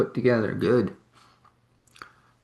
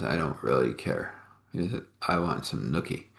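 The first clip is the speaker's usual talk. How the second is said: lower, softer husband voice; husband's voice; 7 semitones lower, 6 dB quieter than usual. Acted. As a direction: drop low and soft, blunt and frank. Husband answers wife in bed.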